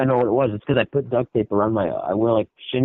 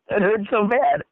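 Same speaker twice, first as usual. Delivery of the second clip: usual speaking voice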